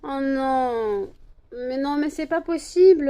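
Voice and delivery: loud, high exasperated cry; exasperated outcry. Real, not acted. Owner exasperated at her dog's antics, loud high outcry. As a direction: throw up your hands, loud.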